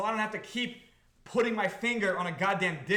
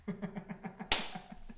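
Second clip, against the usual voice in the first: quiet breathy laugh; 8 dB quieter than usual. Real, not acted. Laugh after thanking chat.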